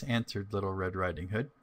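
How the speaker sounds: plain reading voice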